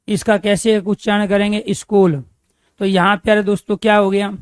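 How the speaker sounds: ordinary talk, nothing marked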